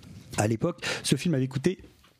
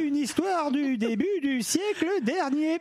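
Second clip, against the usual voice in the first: put-on storyteller for kids; 10 semitones higher, slower than usual. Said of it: "petit papa [name] va vous conter".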